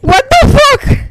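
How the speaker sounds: high, laughing disbelief. Real, not acted. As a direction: laugh it out in shock. Laughing, surprised reaction.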